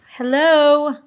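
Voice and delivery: teasing wake-up call; mock hello. Real, not acted. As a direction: call out to the soggy prosciutto in mock surprise. Mocking the wet meat.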